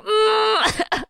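high-pitched reluctant "mm"; strained, high hum of misgiving; doubtful squeal. Retold or imitated.

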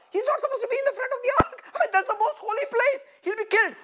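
high, rapid-fire protest; alarmed objector. Acted. Voices objectors' alarm at David.

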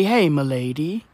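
mock-posh courtly hello; posh milady greeting. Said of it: "It's very posh".

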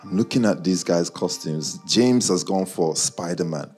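ordinary delivery

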